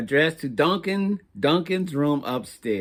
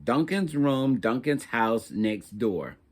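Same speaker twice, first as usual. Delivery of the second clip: plain reading voice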